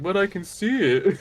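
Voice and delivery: light dim-witted Patrick take; Patrick impression. Retelling a cartoon scene to a teammate, goofy Patrick voice. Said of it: "And Patrick's like".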